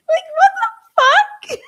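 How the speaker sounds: high, loud laughing disbelief; laughing hard. Real, not acted. Reacting to the Karen question, laughing hard. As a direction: squeal it through helpless laughter. Cracking up at her own quote.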